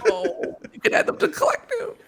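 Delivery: high laughing Borg voice. Pretend. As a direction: squeal it as a Borg.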